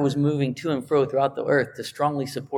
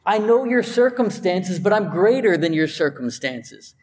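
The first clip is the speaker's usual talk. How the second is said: high, emphatic, bold declaration; God's promise, ringing and emphatic; 6 semitones higher than usual. Acted. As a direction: proclaim it with confident power. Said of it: "I'm being a little emphatic there".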